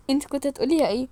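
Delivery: normal talking voice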